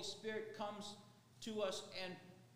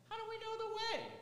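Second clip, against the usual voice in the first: puzzled disciple; 4 dB louder, faster than usual. Acted. Disciple's question in retold scene.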